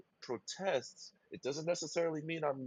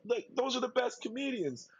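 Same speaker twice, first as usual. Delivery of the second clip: ordinary talk, nothing marked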